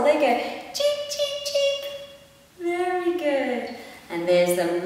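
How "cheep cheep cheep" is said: tiny chick cheeps. Acted. Mum doing the chicks for her toddler, tiny high cheeps. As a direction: peep like a tiny baby chick. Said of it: "'cause they're very little".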